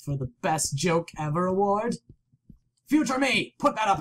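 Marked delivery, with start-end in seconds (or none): 2.9-4.0 high call to someone absent. Pretend. Player calling to future self about the screen, calling out. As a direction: hail your future self, pitched up.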